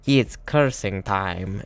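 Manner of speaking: slow, put-on game-hero delivery; imagined cursing catchphrase. Imagined classmate announcing his curse, mock-dramatic voice. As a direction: draw it out like a signature attack call. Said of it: "just imagine him saying".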